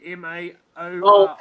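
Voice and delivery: spells it out loudly, letter by letter; loud spelling-out. Real, not acted. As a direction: spell each letter loudly for the friend on the call. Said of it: "No, no, no, Ma".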